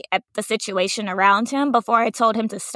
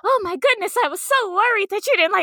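much higher, quick, gushing relief; relieved teacher; 9 semitones higher, faster than usual. Retold or imitated. Kindergarten teacher relieved her class likes reading, high and gushing. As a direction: rush it out in sing-song relief.

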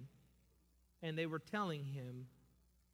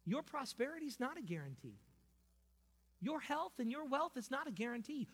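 ordinary talk, nothing marked

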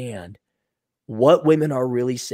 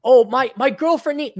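much higher, hammed-up fretting; mock naive guy; 10 semitones higher than usual. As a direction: play the flustered fool.

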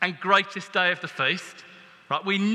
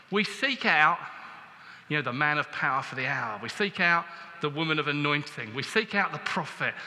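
ordinary delivery

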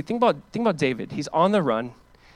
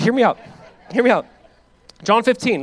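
loud, higher plea to listen; urgent call; 7 semitones higher, 7 dB louder than usual. Real, not acted. Preacher calling his congregation to listen, loud urgent. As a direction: grab the room back, loudly.

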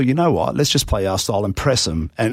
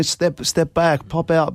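higher, slowed imitation of players' calls; retold on-field calls; players calling out; 6 semitones higher, slower than usual. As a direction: lightly mimic teammates calling instructions. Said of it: "I'm hearing the boys going".

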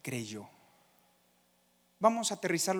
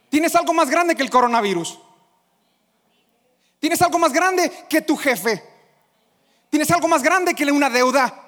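loud, higher, building exhortation; rally the congregation to believe it; full-force preaching; 8 semitones higher, 10 dB louder than usual. Acted. Preacher urging the church to believe.

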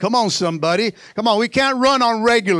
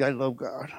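low, much softer "I love God" forced out; through gritted teeth; 8 semitones lower, 11 dB quieter than usual. Retold or imitated. Grudging believer forcing out love for God, gritted. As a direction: squeeze it out through clenched teeth. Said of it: "you grind your teeth".

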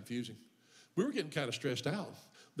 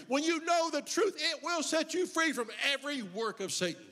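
preaching at full force; 7 semitones higher than usual. Acted. Pastor preaching to the church, rising to full force. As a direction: build the sermon to a shout, lifting high.

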